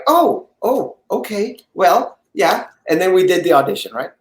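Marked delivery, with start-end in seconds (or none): 0.1-2.7 taken aback. Retold or imitated. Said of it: "And she was like".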